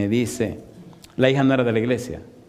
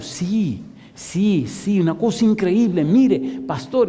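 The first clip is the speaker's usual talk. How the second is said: higher, building excited yeses; excited daughter; 7 semitones higher, slower than usual. Retold or imitated. Daughter tells the pastor her brother called, excited and high. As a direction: bubble over with good news.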